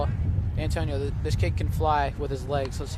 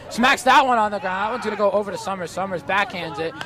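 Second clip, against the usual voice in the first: excited play-by-play; 6 semitones higher, 8 dB louder than usual. Real, not acted. Exciting double play call.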